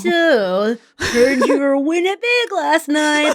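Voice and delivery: hammed-up plotting, slow and high; joking attempt at secretive table talk; mock conspiratorial. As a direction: draw it out like an obvious schemer. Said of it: "Conspiratorially quietly".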